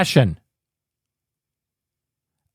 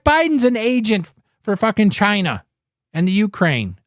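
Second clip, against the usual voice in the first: ordinary delivery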